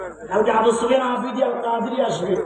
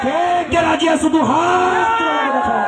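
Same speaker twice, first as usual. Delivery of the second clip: rallying shout; 8 semitones higher than usual. Real, not acted. Preacher calls on the crowd to raise both hands, shouting. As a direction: rouse them to raise their hands.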